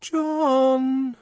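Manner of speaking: high coaxing call through a door; high coaxing call, small and ingratiating. Acted. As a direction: wheedle him from the bathroom. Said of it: "a small, ingratiating voice".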